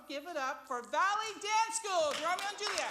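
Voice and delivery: rousing call for applause; hyped introduction. Real, not acted. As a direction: bring the act on with a big cheer. Host introducing act to audience.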